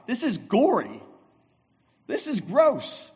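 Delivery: high, grossed-out reaction; disgusted. Real, not acted. Preacher reacting to Thomas's words, grossed out. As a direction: recoil at the gore.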